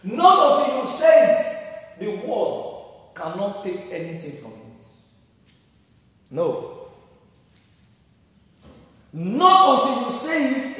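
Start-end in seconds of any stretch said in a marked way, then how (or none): 0.0-2.0 higher and louder, slow and emphatic; pounding slow sermon climax; preaching emphasis. Real, not acted. 9.1-10.8 higher and louder, slow and emphatic; pounding slow sermon climax; preaching emphasis. Real, not acted.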